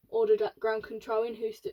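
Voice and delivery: plain reading voice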